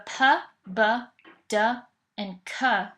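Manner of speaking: p, b, d, k as short bare sounds, not letter names; isolated speech sounds. Shown as a demonstration. Lecturer demonstrating earliest acquired speech sounds, isolated speech sounds. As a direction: sound out p, b, d and k, pausing between each. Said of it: "sounds are all some of the earliest".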